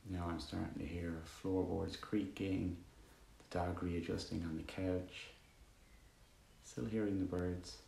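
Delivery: calm, even meditation voice with long pauses; guided meditation. Acted. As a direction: guide listeners gently into stillness, leaving long silences.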